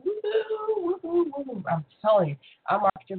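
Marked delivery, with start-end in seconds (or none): 0.0-1.6 high squealing woo-hoo; excited cheer. Real, not acted. Celebrating one-year anniversary.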